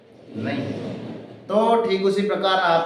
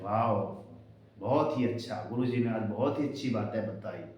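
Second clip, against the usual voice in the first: soft, lower, gentle admiration; calm praise; 8 semitones lower, 9 dB quieter than usual. Retold or imitated. Student softly praises the teacher's lesson, lower and gentle. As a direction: praise the teacher quietly and warmly. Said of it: "अगर आराम से बोल रहे हैं".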